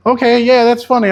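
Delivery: high, acted going-along voice; retold high-voiced masking reply; playing along. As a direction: play along, pitched well up. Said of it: "I went with and rolled with it".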